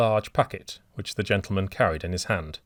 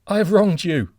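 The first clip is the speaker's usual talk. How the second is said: high, loud shriek; shrieked confession; 11 semitones higher, 8 dB louder than usual. A narrator's reading. Old man confessing his wrong to the stranger, shrieking. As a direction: shriek it out in despair. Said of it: "shrieked the old man".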